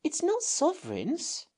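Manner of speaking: put-on high, slow, soft boy's voice; hushed and amazed, slowly; low voice. Robert stares at the coin, hushed and slow. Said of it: "in a low voice, quite different to his own".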